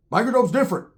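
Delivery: plain reading voice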